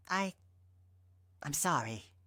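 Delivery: tearful, choking on the words; choked out. Acted. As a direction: force it out through tears. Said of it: "she choked out".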